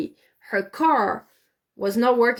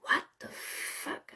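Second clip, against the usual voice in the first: stunned whisper; 14 dB quieter, slower than usual. Retold or imitated. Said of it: "And I'm like".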